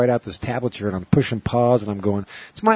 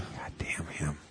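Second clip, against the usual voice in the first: soft, slow muttered whisper; whispered curse; 6 semitones lower, 17 dB quieter, slower than usual. Real, not acted. Joking to the co-host about Alec Baldwin, whispered curse. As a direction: breathe it out slowly under your breath.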